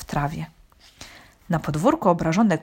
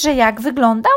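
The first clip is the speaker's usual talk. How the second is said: higher, louder questioning; curious questioning cow, pitched up; inquisitive cow; 5 semitones higher, 6 dB louder than usual. A narrator's reading. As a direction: press for the details. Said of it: "dopytywała się krowa Krasula".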